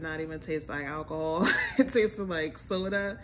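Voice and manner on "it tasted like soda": normal talking voice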